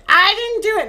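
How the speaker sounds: high, loud mock protest; offended and angry. Mort denying Shooter's accusation, offended and angry. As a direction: imitate an indignant denial, pitch jumping up. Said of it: "acting all offended and angry".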